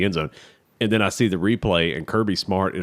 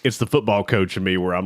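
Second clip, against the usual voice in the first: usual speaking voice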